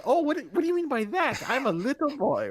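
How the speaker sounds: high, mock-scandalized protest; flustered innocence. Man reacting to a proposition, flustered innocence.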